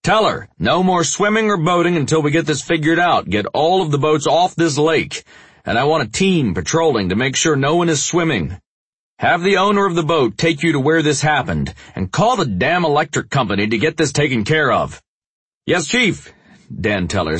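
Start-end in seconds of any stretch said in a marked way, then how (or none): 0.0-15.0 shouting orders. Acted. Chief barking orders at crowd scene. 15.6-16.3 officer voice. Acted. Narrator voices officer, higher.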